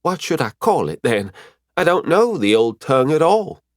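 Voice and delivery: lightly voiced learner at a loss; puzzled pupil. Figure of Valterra asks for help.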